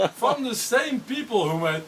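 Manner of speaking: high, slow, laughing announcer; Stewie voice. Retold or imitated. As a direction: drag it out high through a laugh. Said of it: "then he said".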